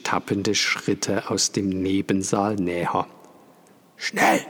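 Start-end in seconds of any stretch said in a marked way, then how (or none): 4.0-4.5 urgent whisper; hissed warning in the dark. A narrator's reading. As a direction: whisper it fast and sharp. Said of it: "flüsterte Vater".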